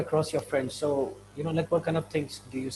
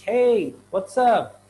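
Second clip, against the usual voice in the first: slow, louder, higher Hey, what's up; friendly greeting; 6 semitones higher, 6 dB louder, much slower than usual. Shown as a demonstration. Teacher acting out greeting a friend loudly. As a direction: greet a friend brightly and slowly. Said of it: "you're meeting with someone and you say".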